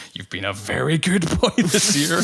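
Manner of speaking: playful hint of a jolly praising figure; Santa. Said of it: "Santa just turns to him and goes".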